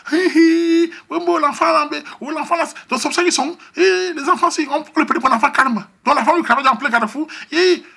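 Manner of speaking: high, loud imitation of the neighbourhood mothers; gossiping mothers. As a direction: cluck it like the mothers watching. Les mamans commentent, imitées. (imitation aiguë et forte des mamans du quartier)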